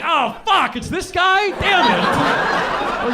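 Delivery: half-laughing mock horror; mock dismay. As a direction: yell it like you just lost a bet.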